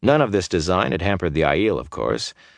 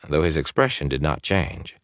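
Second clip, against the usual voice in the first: plain reading voice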